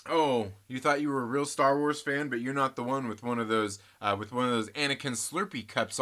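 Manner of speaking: ordinary delivery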